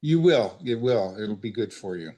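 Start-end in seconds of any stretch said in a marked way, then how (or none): none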